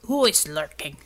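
put-on low barked challenge in accent. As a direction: bark it out, deep and sharp. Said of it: "Untermensch's bark".

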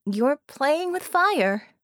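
Harmony's high, slow warning. Acted. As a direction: warn him off. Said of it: "Harmony warned him".

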